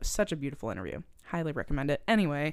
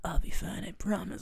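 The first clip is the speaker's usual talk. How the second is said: whispered; 2 semitones higher than usual. Retold or imitated. Jack whispering his promise to Rose, hushed. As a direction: murmur it gently, almost no voice.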